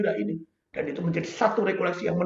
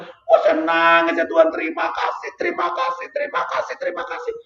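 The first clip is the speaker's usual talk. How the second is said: high, breaking thank-yous to God; tearful prayer of thanks; 11 semitones higher than usual. Retold or imitated. Priest retelling his tearful prayer, voice high and breaking. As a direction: sob out the thanks, voice climbing high. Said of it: "[name] berdoa".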